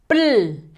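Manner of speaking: "pl" as a bare blend, holding the l; isolated consonant sound. Shown as a demonstration. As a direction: sound out "pl" with no clear vowel after it. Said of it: "el sonido es". ("pl" como sonido suelto, alargando la l)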